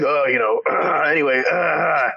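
mock sheepish brush-off with throat clear; awkward dodge. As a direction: shrug it off awkwardly. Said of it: "And he's like".

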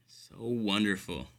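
usual speaking voice

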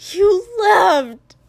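loud, high anguished cry. A narrator's reading. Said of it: "his voice went very high".

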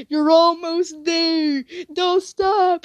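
a hint of Dally, slow and urging; lightly voiced tough guy, coaxing; Dally's encouragement. Dally urging her on mid-shift, lightly voiced and slow. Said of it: "I just hear Dally out of nowhere just go".

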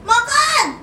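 a loud, high yell of the single word; shouted from afar. Retold or imitated. Child yelling to parents from far away, loud high yell. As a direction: holler it across the house. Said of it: "kita dari jauh bilang".

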